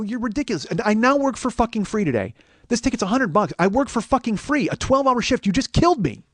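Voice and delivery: high-pitched aggrieved rant; acted-out exasperated outrage; retold irate complaint. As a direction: complain as if robbed of a day's pay. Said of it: "I'm kinda yelling".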